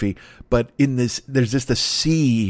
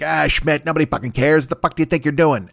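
higher-pitched grouchy boss impression; J. Jonah Jameson; 4 semitones higher than usual. Critic in his head berating him, grouchy boss impression. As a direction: berate like a cartoon newspaper boss. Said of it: "why does J. Jonah Jameson sound like".

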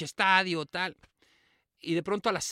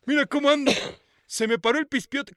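high, loud hammed-up doctor; mock doctor voice; 9 semitones higher, 9 dB louder than usual. Doctor showing off his morning state, loud and high. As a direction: ham up the flustered doctor.

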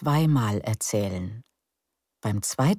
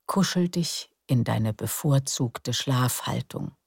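guided meditation. Acted. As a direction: lull them into calm breathing. Leads breathing relaxation. (ruhige Meditationsstimme)

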